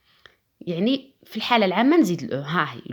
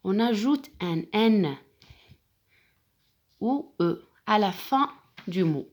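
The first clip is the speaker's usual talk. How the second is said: slow dictation; slower than usual. Shown as a demonstration. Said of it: "teacher dictates rule".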